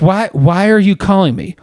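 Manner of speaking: puzzled voice on the phone; confused caller. Retold or imitated. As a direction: ask it baffled.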